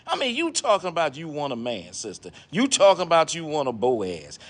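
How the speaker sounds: ordinary talk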